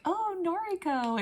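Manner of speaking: high-pitched, gushing hello; mock-excited friendly greeting; delighted greeting. Visiting boys greet Noriko.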